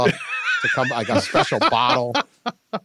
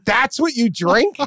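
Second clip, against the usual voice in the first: higher, louder mocking disbelief; incredulous mockery; 9 semitones higher, 3 dB louder than usual. To friends, mocking the strongman's peach schnapps choice, incredulous. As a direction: scoff at the giant's dainty drink.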